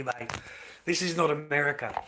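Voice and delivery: normal talking voice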